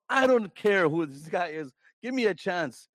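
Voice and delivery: usual speaking voice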